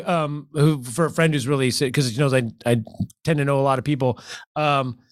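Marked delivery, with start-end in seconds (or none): none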